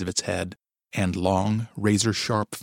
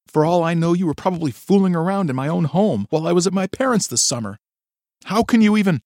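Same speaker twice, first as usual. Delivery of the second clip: higher, louder, fast furious wife; angry accusation; 9 semitones higher, 6 dB louder, faster than usual. A narrator's reading. Wife accuses her husband of cheating, furious. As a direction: fling the accusation at him.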